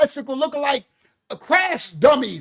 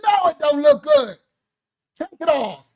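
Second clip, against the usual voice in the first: firm rejection; 5 semitones higher, 5 dB louder than usual. Retold or imitated. Voicing himself rejecting makeup.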